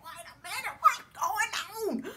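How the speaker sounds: high, baffled squeal, stretched at the end; confused interjection. Retold or imitated. Woman interrupting his rant about Gina, confused. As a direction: imitate trying to break into a rant, bewildered. Retells her confused response.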